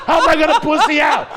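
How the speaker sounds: loud, high, pumped-up yelling; hyped-up shouting. Retold or imitated. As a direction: build up to a fight. Hyped-up retold fight resolve.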